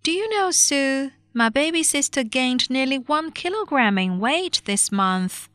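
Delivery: put-on high-pitched, cheerful girl's line. A narrator's reading. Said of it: "said to Susan cheerfully".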